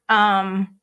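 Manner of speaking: ordinary talk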